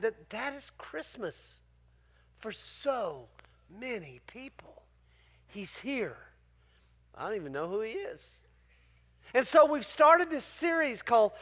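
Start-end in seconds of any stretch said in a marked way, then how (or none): 0.0-4.8 much softer, slow and reflective; hushed. Real, not acted. Reflective sermon point. 5.5-8.4 hushed reflection. Real, not acted. Quiet repeat of the key line.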